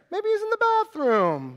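high-pitched silly taunt; =sing out a mock suggestion. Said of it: "begins to mock them".

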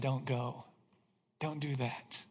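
quiet voice of God saying don't; soft warning. Retold or imitated. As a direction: voice God's quiet caution. God's warning voiced softly.

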